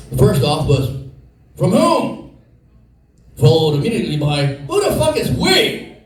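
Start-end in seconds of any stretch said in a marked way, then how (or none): 1.6-2.3 pitched-up incredulous "From whom?"; baffled question. Retold or imitated. His own baffled inner question. 4.4-5.9 high, slow, stunned disbelief; incredulous outrage. Retold or imitated. His own baffled inner question.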